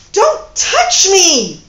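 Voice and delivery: very loud, high, slow furious shout. Acted. Woman pulling away from her lover, furious loud shout. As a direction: yell it to stop his hand. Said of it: "she shouted".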